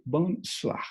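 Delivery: bonsoir said bon-swa, rising at the end; French pronunciation. Shown as a demonstration. Teacher repeating bonsoir for beginners, oi as wa. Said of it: "que será O A".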